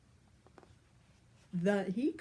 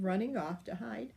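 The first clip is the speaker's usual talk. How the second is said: ordinary delivery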